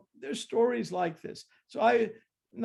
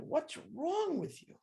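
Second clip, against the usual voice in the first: much higher, mock-scolding voice; mocking high reproach; 11 semitones higher than usual. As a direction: squeak it out like a nagging devotee. Devotees scolding, mocked higher.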